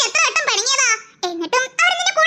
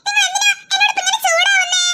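high-pitched, hammed-up wounded innocence; overacted hurt; 6 semitones higher than usual. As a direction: play the wronged innocent far too hard. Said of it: "over അഭിനയിക്കല്ലേ". (അമിതാഭിനയത്തോടെ സങ്കടം നടിച്ച്)

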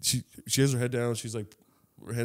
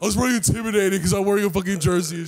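put-on higher, whiny girl voice; mock whiny excuse; 7 semitones higher, faster than usual. Playing the girl making an excuse, high and whiny. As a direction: ham up her lame excuse. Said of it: "But you say".

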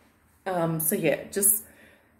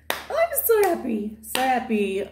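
much higher, bubbling with joy; gushing happy; 4 semitones higher than usual. Real, not acted. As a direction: gush with delight. Happy about new home.

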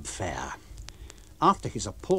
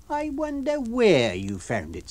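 high, slow insinuation; Mrs Hall, suspicious; 10 semitones higher, slower than usual. Acted. Landlady doubting the lodger's money. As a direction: needle him with doubt.

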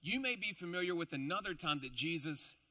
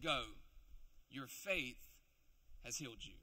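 softer and lower, tender; gentle blessing; 5 semitones lower, 6 dB quieter than usual. Acted. Jesus blessing the healed beggar, gentle and soft. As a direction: say it like a gentle gift. Jesus speaks healing softly.

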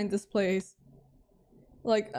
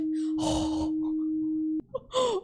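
long high gasping wail; drawn-out gasp of fright; high, whimpering gasp; frightened gasp; 6 semitones higher than usual. Real, not acted. Frightened by close call in game.

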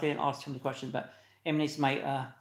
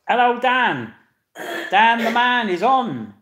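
loud, high, drawn-out greeting shout; booming host announcing a guest; 7 semitones higher, 10 dB louder, slower than usual. Real, not acted. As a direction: belt out the welcome across the room. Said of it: "don't know why I'm shouting so loud".

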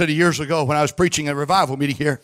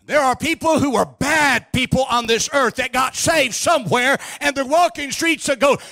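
high, forceful revival sermon delivery; fervent preaching; 10 semitones higher than usual. Real, not acted. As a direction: drive it home to the congregation, drawing out key words.

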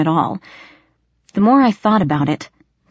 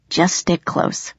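muttered; slower than usual. Acted. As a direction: mutter it close and slow. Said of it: "he muttered".

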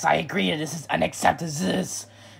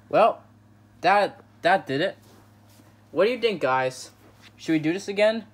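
ordinary delivery

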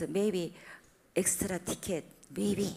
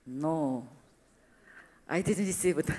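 ordinary talk

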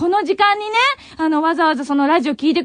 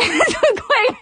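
a laugh breaking into tears; teary half-laugh; tearful laugh; 7 semitones higher than usual. Real, not acted. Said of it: "声がヘロヘロになっちゃった".

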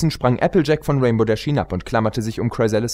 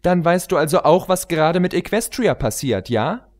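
put-on high, eager young pony voice; pony heroine; 8 semitones higher than usual. Pony heroine questioning the queen, high and urgent. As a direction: press her urgently, pitched up.

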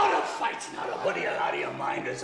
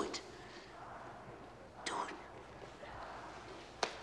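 hushed, pressing whisper; urgent whisper; 17 dB quieter, much slower than usual. Acted. Wife urges the fighter to go back out, whispering. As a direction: breathe the order right in his ear.

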